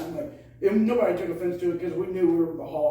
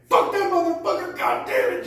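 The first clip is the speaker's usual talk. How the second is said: high, loud imitated angry muttered rant; cussing; 12 semitones higher, 4 dB louder than usual. Teammate cussing all through the lap, imitated angry outburst. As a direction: imitate a fed-up runner cursing the coach. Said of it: "cussing to me".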